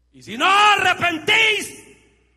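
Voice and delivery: slow, high, booming shout; thundering quote. Acted. Preacher quoting Christ to the congregation in a slow shout. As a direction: proclaim Christ's words like thunder.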